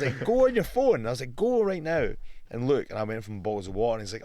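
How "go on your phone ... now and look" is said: high, loud mock insistence; half-shouting the retold demand; retelling how he insisted the guy check his phone, raised and loud; retold insistent shout. Retold argument with a doubter.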